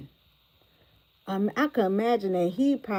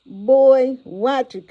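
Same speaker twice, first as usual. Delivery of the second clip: loud call; 4 semitones higher, 12 dB louder, much slower than usual. Real, not acted. Warning Jonelle about the red flags, loud.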